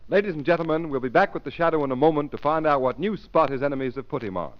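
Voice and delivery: normal talking voice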